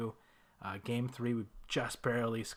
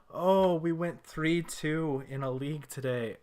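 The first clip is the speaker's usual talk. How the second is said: excited; 4 semitones higher, 6 dB louder, slower than usual. Real, not acted. After winning the match.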